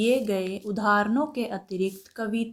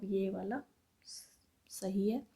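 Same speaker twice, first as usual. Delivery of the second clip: whisper; 9 dB quieter than usual. Real, not acted. Teacher marks the correct word.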